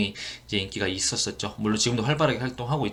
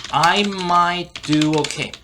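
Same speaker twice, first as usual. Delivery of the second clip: higher, slowly stretched English words; slow model reading; 4 semitones higher, 4 dB louder, much slower than usual. Shown as a demonstration. Teacher repeats the clip for learners, slow model reading. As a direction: dictate it slowly, pitch lifted.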